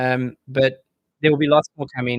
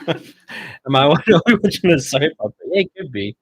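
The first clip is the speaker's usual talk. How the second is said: laughing; 5 semitones higher, 9 dB louder than usual. Real, not acted. Laughing at soap opera.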